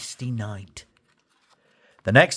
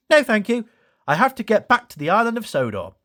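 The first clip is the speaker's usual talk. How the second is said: Thomas voice; 8 semitones higher, faster than usual. Acted. Thomas refuses the locos.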